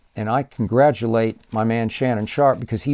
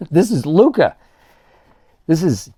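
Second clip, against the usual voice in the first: loud, higher burst of excitement; excited exclaim; 4 semitones higher, 4 dB louder than usual. Real, not acted. Host hyping up Luka to viewers, excited exclaim. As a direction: announce it with a thrill.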